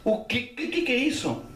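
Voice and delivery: high-pitched bewildered retelling; alarmed disbelief. Retold or imitated. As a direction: act out rising disbelief in a high voice. Uncle alarmed to see boy underwater. (espanto encenado, voz bem aguda)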